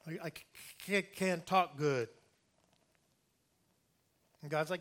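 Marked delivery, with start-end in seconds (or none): 0.1-2.1 halting imitated stutter on the hard c; stuttering Moses. Moses telling God he can't talk, stuttering. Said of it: "or the stutter".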